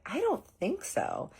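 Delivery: high, slow and unsure; drawn-out doubtful denial. Retold or imitated. Telling Todd she doubts they hooked up, high and slow. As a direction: stretch it out like you really doubt it. Doubting friend's hookup question.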